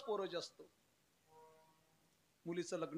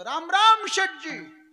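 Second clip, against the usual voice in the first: slow, much higher, booming greeting; loud shout; 8 semitones higher, 17 dB louder, slower than usual. Retold or imitated. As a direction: bellow the greeting up to the fat moneylender. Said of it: "मोठ्याने म्हटलं".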